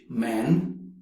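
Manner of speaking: man with a British vowel. Shown as a demonstration. Teacher showing British man, British accent.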